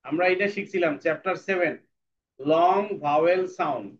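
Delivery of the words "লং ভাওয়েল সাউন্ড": slow dictation. Shown as a demonstration. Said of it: "teacher repeats the term slowly".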